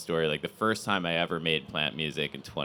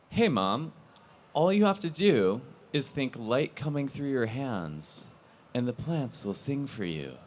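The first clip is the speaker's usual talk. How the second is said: put-on bright, higher child's voice telling mom a secret; little girl voice; 6 semitones higher than usual. A young daughter explaining to her mom, in a higher child's voice. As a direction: play the excited little girl, pitched up. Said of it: "she turns to her mom and she says".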